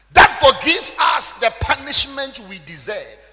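loud, high, drawn-out preaching shout; full-force preaching. Acted. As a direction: thunder the scripture at the congregation. Preaching on mercy.

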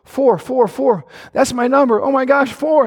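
a woman hearing her secret number named, stunned and elated; excited disbelief. Retold or imitated. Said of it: "this is what she said".

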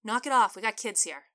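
loud firm scolding, strongest on "Knock it off"; snapping reprimand. Acted. Roman scolds the loud customer because kids are present, loud and firm. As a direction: shut the rowdy customer down. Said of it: "Roman snapped".

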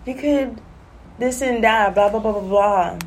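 high, mocking singsong of other people; mocking imitation. Mocking people who say you never know. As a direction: parody the know-it-alls who always say this. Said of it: "Always wanna say".

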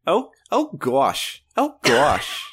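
exaggerated Midwest accent; Midwest accent attempt. Shown as a demonstration. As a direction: draw it out in mock Midwestern. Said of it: "hit me with your, with your Midwest".

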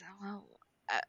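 soft, reluctant grumble; begrudging. Acted. Urza about to sign a contract she doesn't understand, begrudging. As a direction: say it quietly, as a grudging concession. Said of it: "begrudgingly and says".

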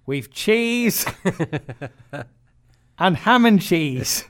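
high, loud, drawn-out mock barman; unhelpful Irish barman. Barman answering a request for just ham, pointedly slow. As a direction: repeat the menu slowly and pointedly through laughter. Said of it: "Barman looks at him and goes".

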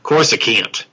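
"Course I can't", Coruscant ending in a hard 'can't'; childhood mispronunciation. Shown as a demonstration. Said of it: "I remember pronouncing it to myself".

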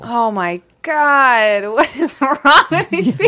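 drawn-out Oh my God, rising to high exasperation; escalating comedy rant. Real, not acted. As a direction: build from slow disbelief to loud outrage. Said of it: "reacting to news".

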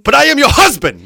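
high, very loud husband's protest; indignant shout. Acted. Husband protesting to his wife, indignant shout. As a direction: burst out, hammed-up and indignant.

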